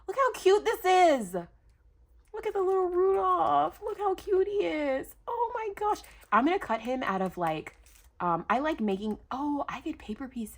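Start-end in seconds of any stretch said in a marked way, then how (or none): 0.1-6.0 high-pitched gush; cooing with delight. Real, not acted. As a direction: coo over something adorable, up high. Gushing over a little Rudolph.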